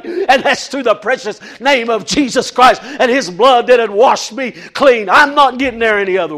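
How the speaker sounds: loud, driving revival sermon delivery; fiery preaching. Acted. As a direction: preach it out full-throated to the congregation. Preacher at full force.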